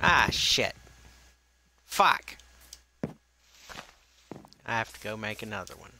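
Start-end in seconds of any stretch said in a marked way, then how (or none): 0.1-2.4 sharp, high, loud curse; frustrated curse. Real, not acted. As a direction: blurt it out annoyed. Reacts to breaking tool.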